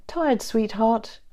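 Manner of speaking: slow tender question to a sleepy fiancée. A narrator's reading. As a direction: ask gently, drawing it out. James tenderly asks sleepy narrator.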